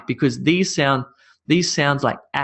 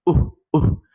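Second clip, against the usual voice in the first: short rounded ooh sounds like a playful monkey; 3 semitones higher than usual. Shown as a demonstration. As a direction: hoot the ooh a little like a monkey. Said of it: "I sound like a bit of a monkey when I do this".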